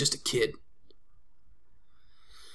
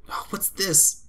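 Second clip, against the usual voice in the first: put-on much higher child voice, slow; little girl voice; 7 semitones higher, much slower than usual. Child Mai coughing after sniffing a jar, high girl voice. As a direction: play the kid, whiny and high.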